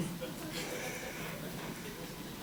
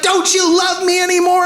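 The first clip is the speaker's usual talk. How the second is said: hurt wife; 10 semitones higher than usual. Retold or imitated. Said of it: "She's like".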